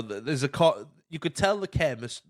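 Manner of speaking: ordinary talk, nothing marked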